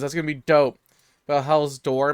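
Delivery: ordinary talk, nothing marked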